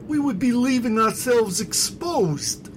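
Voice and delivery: high, loud, worried protest; anxious objector. Acted. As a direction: protest in alarm. Basto protests.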